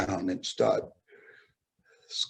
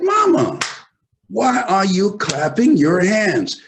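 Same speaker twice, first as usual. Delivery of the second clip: put-on much higher, loud, slow child's call; 10 semitones higher, 9 dB louder than usual. Boy calling to his mother from across the house, high and loud. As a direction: call out to mama, a boy who wants to know.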